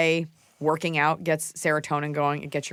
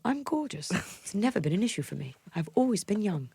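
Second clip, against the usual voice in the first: higher-pitched British actress impression; British accent; 5 semitones higher than usual. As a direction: put on a British accent as a film star. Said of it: "Just do a British accent".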